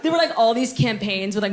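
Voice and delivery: ordinary delivery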